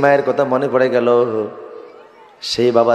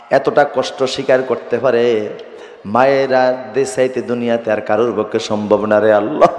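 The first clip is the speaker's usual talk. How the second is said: ordinary talk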